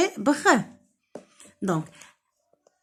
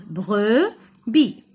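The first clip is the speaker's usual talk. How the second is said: brebis as "bre... bi", a gap between; syllable by syllable; 3 semitones lower than usual. Shown as a demonstration. Teacher showing children two syllables, brebis split apart. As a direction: separate both syllables of brebis clearly. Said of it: "deux syllabes".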